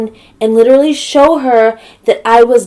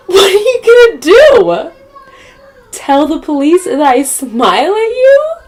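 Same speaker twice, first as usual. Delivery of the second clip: loud, high, incredulous taunt; mocking challenge; 10 semitones higher, 8 dB louder than usual. Taunting the neighbor over calling the police, loud mocking voice. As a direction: throw the absurd question back, high and loud.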